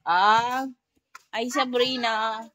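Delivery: loud, high call of the name. Real, not acted. Mother calling Sabrina to come, loud high call. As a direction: call Sabrina from across the house.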